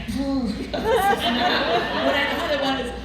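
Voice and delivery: high, loud, very slow and laughing through the words; laughing high squeal. Retold or imitated. Retelling the horseradish bite to the women, laughing high and loud. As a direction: squeal it out slowly while cracking up.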